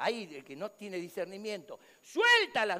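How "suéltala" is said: high, loud order; shouted command. Retold or imitated. Onlooker without discernment orders her released, shouted command. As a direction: insist sharply on release.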